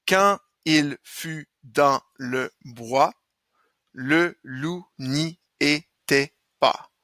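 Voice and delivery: slow, each syllable set apart. Shown as a demonstration. Teacher counting six syllables per verse, slow syllable-by-syllable reading. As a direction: count out the line beat by beat. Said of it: "Donc, il y a six syllabes".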